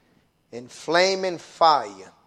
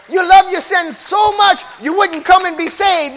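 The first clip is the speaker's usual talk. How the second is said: high, loud shout; preaching shout; 12 dB louder than usual. Acted. Preacher shouting at sinners who won't come, loud. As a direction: roar it at the sinners.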